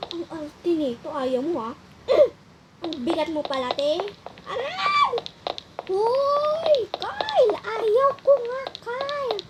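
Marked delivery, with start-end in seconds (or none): 4.4-9.4 high, drawn-out pleading cries; high-pitched reluctant yelping; whining protest. Acted. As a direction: wail at a friend to stop. Resisting friend, calling out in protest.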